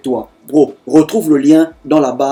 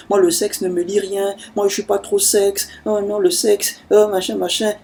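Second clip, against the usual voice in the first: mock prudish woman; 5 semitones higher, 5 dB quieter than usual. Mocking women who say sex means nothing to them, high and soft. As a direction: mimic a woman playing innocent, pitched up and hushed. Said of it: "elles sont là, non".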